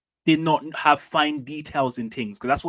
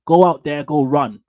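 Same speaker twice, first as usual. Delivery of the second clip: loud punchy command from the manager; mock coach shout; 8 dB louder than usual. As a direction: bark it out like a coach sending a sub on. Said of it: "He tells you".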